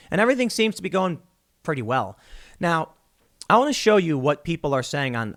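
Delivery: usual speaking voice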